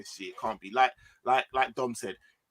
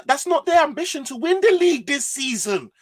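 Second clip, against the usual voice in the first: heated; 10 semitones higher, 5 dB louder than usual. Real, not acted. Heated argument about club ambition.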